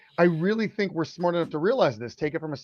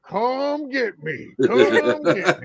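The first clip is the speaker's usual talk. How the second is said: higher, very slow, sing-song taunt, laughing at the end; drawn-out teasing come get me, much slower; mocking taunt; 5 semitones higher, 4 dB louder, much slower than usual. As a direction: imitate a kid gloating in a game. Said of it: "He's like".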